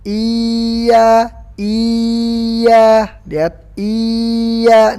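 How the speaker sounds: mocking the common mistake; wrong reading. Shown as a demonstration. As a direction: stretch "iya" as if it had a long vowel. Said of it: "orang bacanya gini".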